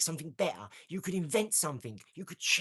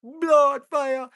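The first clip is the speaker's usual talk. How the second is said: loud, high hype shout; loud excited battle cry; hyped shout; 10 semitones higher, 15 dB louder than usual. Real, not acted. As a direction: roar it like a rallying cry.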